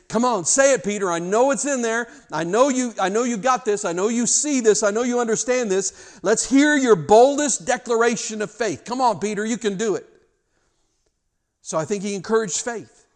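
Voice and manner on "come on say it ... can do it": high, loud, eager coaxing; urging encouragement. Acted. Jesus urging Peter to declare his faith, fervent encouragement. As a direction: egg him on like a believing coach. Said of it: "it's like he's saying".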